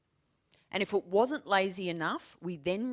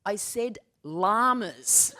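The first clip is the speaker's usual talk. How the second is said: put-on slow kid explaining; 2 semitones higher, slower than usual. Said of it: "she said".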